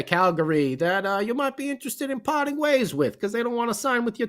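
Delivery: high, slick sales-pitch voice, lightly put on; mock Fitzgerald phone call. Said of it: "Is that how Fitzy talks?".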